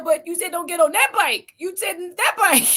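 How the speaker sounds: put-on protesting boy, crying. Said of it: "He said".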